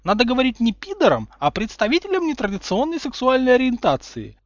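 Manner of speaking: high, lecturing know-it-all voice; prim correction. A narrator's reading. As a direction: correct him like a strict teacher. Hermione correcting primly. (назидательно, высоким голосом)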